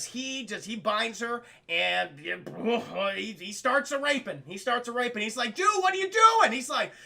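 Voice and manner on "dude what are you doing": high, loud mock disbelief; appalled protest. Robber objecting to his partner's attack, acted loud protest. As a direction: protest loudly, voice jumping up.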